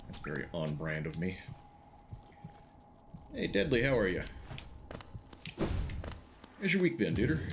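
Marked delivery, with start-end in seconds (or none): 3.3-7.4 put-on country accent. Streamer greets a chatter in a mock country accent. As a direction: greet Deadly like a laid-back country cowboy. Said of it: "Without the accent".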